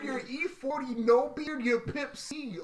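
ordinary talk, nothing marked

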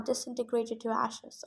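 ordinary delivery, nothing marked

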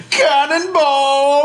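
drawn-out high, loud yell; long cannonball shout; gleeful battle cry. Pretend. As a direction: holler it as you leap. Barry leaps into the trash.

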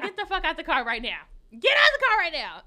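high, very loud shout imitating her; retold yelling. Mother ordering the driver out of the car, yelling. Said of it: "she started to try to yell at me".

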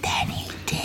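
teasing whispered aside. Real, not acted. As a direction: breathe it like a secret.

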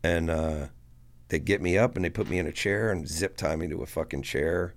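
plain reading voice

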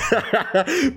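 short high burst of laughter; laughing. Real, not acted. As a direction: lose it for a moment. Laughs at the story.

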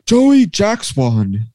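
high, slow drawn-out home run call; John Sterling call. Retold or imitated. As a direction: stretch it like a radio announcer calling a homer. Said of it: "his call for Joey Gallo is".

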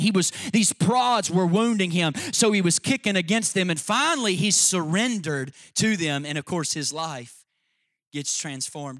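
loud, driving sermon climax; preaching at full force. Acted. Preacher to congregation, loud full-force preaching. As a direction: preach it hard to the crowd. Preacher builds on Paul's conversion.

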